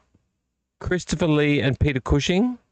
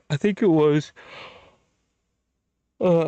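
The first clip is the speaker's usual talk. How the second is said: words pushed through a yawn; 4 semitones higher than usual. Real, not acted. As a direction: say it while yawning. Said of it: "this yawning's not stopping".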